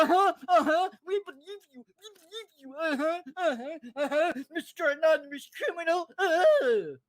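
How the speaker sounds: high, sing-song mock of people humouring him; mocking dismissive people. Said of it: "And people go".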